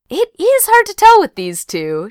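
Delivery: high, drawn-in confidential aside; playful mock-secret tone; conspiratorial. A narrator's reading. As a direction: share it like gossip, pitched high. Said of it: "she said conspiratorially".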